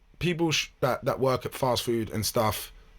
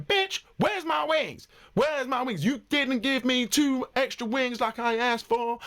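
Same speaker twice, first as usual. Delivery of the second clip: high, drawn-out mimicry of an entitled customer; exaggerated high-pitched demand, stretched for ridicule; mock indignant complaint; 11 semitones higher, slower than usual. Rude customer coming back over missing wings, mock indignant.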